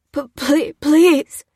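high, loud, broken begging; stammered plea. A narrator's reading. Old man facing his captor, stammering. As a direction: stutter into a desperate please. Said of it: "stammered the old man".

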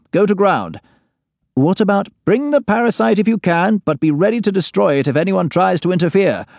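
high, firm commanding boss voice; stern boss orders. Acted. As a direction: lay down the law to your agent. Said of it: "Then he lets me have it".

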